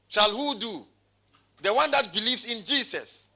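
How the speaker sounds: ordinary talk, nothing marked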